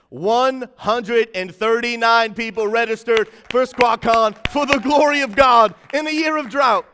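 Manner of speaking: building celebratory cry; triumphant announcement. Real, not acted. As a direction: proclaim it to the rafters.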